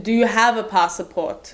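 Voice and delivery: slow, hammed-up foreign accent; put-on Borat-like accent. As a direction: imitate the official with a bad foreign accent. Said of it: "I'm gonna stop doing the accent".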